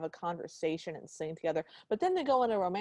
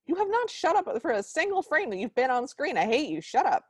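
raised, high-pitched indignant rant; fed-up, scolding outburst at a film character; exasperated outburst; 7 semitones higher, 5 dB louder than usual. Pretend. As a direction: snap back at him in mock fury. Ranting back at the character.

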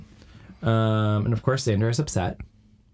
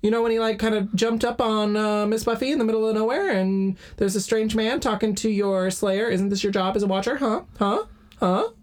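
much higher, nagging accusation; high mock accusing voice; 10 semitones higher than usual. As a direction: needle him with a pestering huh. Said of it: "Or like".

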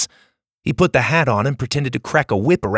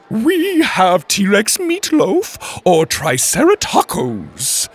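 high, slow, sing-song cook; 9 semitones higher, 4 dB louder, much slower than usual. Acted. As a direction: lilt it like a cheerful canteen cook. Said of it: "again in her sing-song voice".

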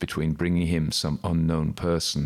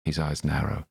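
ordinary delivery, nothing marked

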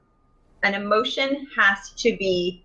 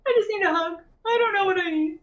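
put-on sad child; 9 semitones higher than usual. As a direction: drag it out like a sad toddler. Child asks for hug.

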